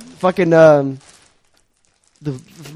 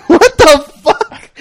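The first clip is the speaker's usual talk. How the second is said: laughing disbelief; 8 dB louder, much slower than usual. Real, not acted.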